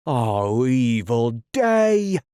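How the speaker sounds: slow, loud, high dramatic wail; crying out. Acted. Starkey wailing at the captain's news. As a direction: wail it to the skies. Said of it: "cried Starkey".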